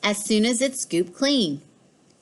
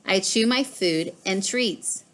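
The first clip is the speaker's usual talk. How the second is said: plain reading voice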